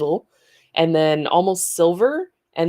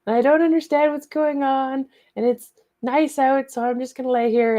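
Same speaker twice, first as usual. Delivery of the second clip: a higher put-on voice for the dog; voicing the dog; 9 semitones higher, 4 dB louder than usual. The city dog complains about the muddy barn, in a high put-on voice. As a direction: speak as the fussy city dog, pitched up. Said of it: "was like".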